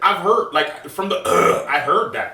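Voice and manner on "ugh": loud imitated grunt of annoyance; exaggerated "ugh"; annoyed groan. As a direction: groan like a fed-up partner. Repeats the annoyed partner's noise.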